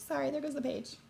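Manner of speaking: plain reading voice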